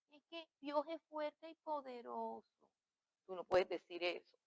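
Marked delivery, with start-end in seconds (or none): 0.1-2.4 tiny, high, very soft frightened-chick voice; scared little chick. Shown as a demonstration. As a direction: squeak it out feebly like a frightened chick. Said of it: "Una voz de pollito asustado". (voz de pollito asustado)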